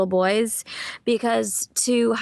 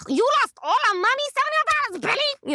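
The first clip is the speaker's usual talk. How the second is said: much higher, fast Brooklyn-tinged Rosie Perez voice; Rosie Perez impression; faster than usual. Shown as a demonstration. The voice finally clicks into Rosie Perez, much higher and faster. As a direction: nail her voice, climbing up high and quick. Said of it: "it finally, like, clicked into her sort of tones".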